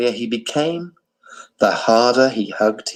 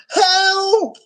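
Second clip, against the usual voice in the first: wailing for help. A narrator's reading. Abu's mother wailing as her baby drowns. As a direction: cry out in panic. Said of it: "wailed Abu's mother".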